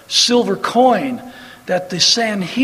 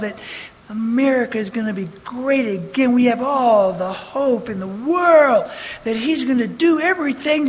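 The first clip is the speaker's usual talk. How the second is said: mocking voice; 4 semitones higher than usual. Preacher mimicking Christians who trust Trump, mocking and rising. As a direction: sneer at their blind faith as it builds. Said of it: "mocking Trump-trusting Christians".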